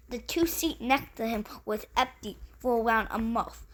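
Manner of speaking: plain reading voice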